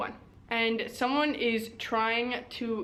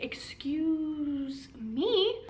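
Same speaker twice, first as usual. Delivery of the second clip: offended excuse me; 4 semitones higher, much slower than usual. Retold or imitated. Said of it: "she's like".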